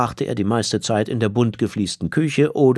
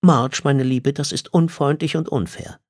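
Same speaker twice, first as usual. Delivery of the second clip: gentle scolding; 6 semitones higher than usual. Acted. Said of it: "sagte Liz".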